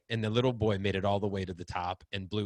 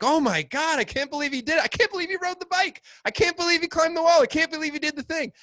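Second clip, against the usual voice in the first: much higher, louder amazed gushing; blown-away parent; 6 dB louder, faster than usual. Retold or imitated. Parent amazed at their child's progress, gushing. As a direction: gush over your child's feat. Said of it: "we're like".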